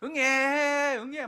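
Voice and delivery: high, loud mock newborn wail; hammed-up baby cry. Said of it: "모세가 막 울었겠죠".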